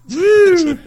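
high loud woo. Real, not acted. Reacting to big sneeze.

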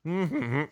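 casual little hummed tune; imitated humming. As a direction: mimic someone humming to himself. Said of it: "the humming goes like this".

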